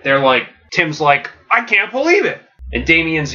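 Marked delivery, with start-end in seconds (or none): 1.5-2.4 high-pitched, acted disbelief. Tim hearing Alfred's confession, stunned disbelief. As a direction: exclaim it as if betrayed and stunned. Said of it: "they can't believe it".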